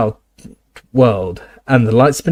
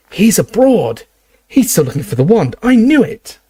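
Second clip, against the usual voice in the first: high, loud burst of excitement; excited; 9 semitones higher, 3 dB louder than usual. Acted. Harry excitedly telling Ron and Hermione, high and eager. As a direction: blurt it out, thrilled to be right. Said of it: "asked Harry excitedly".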